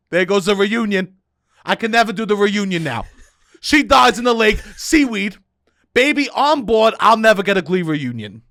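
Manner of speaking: high, loud hysterical mom; shrieking mother. Retold or imitated. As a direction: wail it like a panicked mother. Mother voiced, escalating rant.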